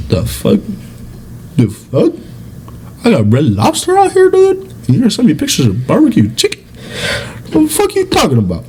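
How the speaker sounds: high-pitched, incredulous griping; hammed-up offence at a lesser meal; a little mad. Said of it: "I get a little bit mad".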